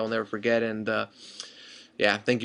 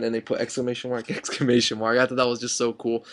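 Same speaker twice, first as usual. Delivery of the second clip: ordinary talk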